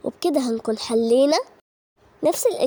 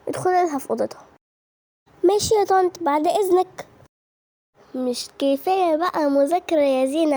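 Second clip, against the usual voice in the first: normal talking voice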